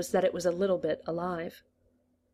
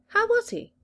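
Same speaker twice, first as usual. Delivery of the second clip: much higher, eager question; interested; 5 dB louder than usual. A narrator's reading. The master eager about Shakespeare, interested. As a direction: perk up with curiosity. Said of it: "interested now".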